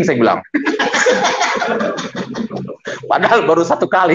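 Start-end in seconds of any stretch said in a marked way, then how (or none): 0.5-3.0 short unvoiced laugh; breathy laugh. Real, not acted. Preacher laughing at his own story, airy laugh. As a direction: laugh at the joke.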